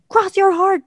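high, loud, playful mimicry of a bossy character; punchy high-pitched movie quote; acted insistent demand. Acting out young Ellie's demand.

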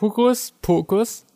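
drawn out hocus pocus; slow magic spell. Pretend. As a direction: intone it like a magician. Magic words, playful reply. (langsam gesprochener Zauberspruch)

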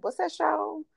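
ordinary talk

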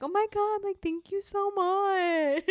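high, slow, choked-up thank you; tearful thanks. Retold or imitated. Host retelling thanking a listener, high and teary. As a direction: gush thanks through tears.

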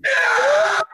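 high, drawn-out imitated yell; distant yell. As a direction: cry out like someone far down the hall. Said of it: "someone yelling in the background going".

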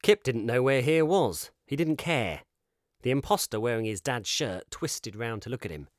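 plain reading voice

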